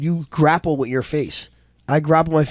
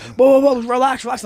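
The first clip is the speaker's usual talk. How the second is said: high-pitched imitation of a guard; urgent calming; 10 semitones higher, 4 dB louder than usual. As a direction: act out hurried pleas to settle down. Guard calming him in retold scuffle.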